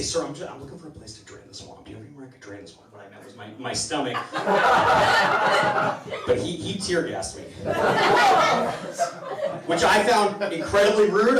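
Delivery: normal talking voice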